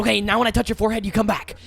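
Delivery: loud, high, rapid-fire imitation; hurried mock instruction. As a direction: rattle it off high and fast. Acting out the lady's trick, hurried.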